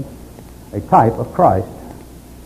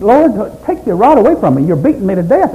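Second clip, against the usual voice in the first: much higher, louder begging cry; pleading to God; 8 semitones higher, 4 dB louder than usual. Retold or imitated. Job begging the Lord to take the rod away, high anguished plea. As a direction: beg the Lord to stop the beating.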